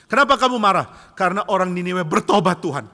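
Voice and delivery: loud, high dramatized dialogue; acted indignant outburst; heated exchange. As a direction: shout the grievance up to God. God and angry Jonah acted.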